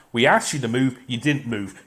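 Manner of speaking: ordinary talk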